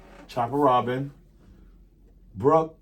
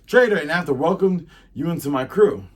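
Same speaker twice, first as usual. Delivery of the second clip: raised, loud accusing voice; acted indignation; 6 semitones higher, 6 dB louder than usual. Pirate scolds the new crew member as traitor, acted indignation. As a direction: throw the accusation out loudly.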